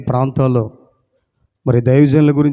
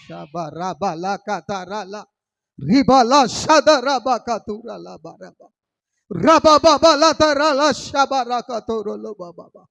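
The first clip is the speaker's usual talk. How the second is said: speaking in tongues; 8 semitones higher, slower than usual. Real, not acted. Praying in tongues after preaching.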